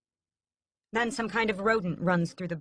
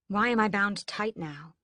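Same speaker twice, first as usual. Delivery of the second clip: plain reading voice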